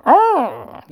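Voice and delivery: high, loud bigfoot yelp; Sasquatch voice. Retold or imitated. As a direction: bellow it like a bumbling Bigfoot. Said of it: "a Sasquatch voice".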